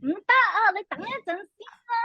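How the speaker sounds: high hammed-up panic; mock climax. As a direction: overact it, rising. Answers mock climax line.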